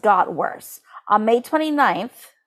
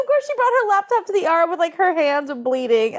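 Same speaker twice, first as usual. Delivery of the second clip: laughing; 7 semitones higher than usual. Real, not acted. Laughing at Susan's ER laptop.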